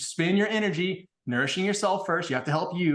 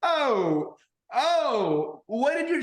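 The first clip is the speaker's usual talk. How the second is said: stunned outcry; 7 semitones higher, much slower than usual. Retold or imitated. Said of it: "And I was like".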